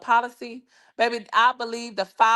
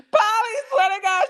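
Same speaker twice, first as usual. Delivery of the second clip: laughing hard; 14 semitones higher than usual. Real, not acted. Cracking up at Polly's joke about the friend, high laughing shriek.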